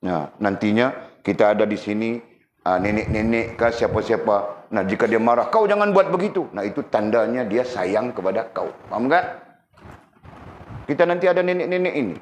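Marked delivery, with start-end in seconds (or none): 5.5-6.5 scolding grandma. Retold or imitated.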